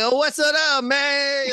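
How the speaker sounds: high, hammed-up New York accent; exaggerated high-pitched New York greeting; faked New York accent. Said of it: "could have faked his accent".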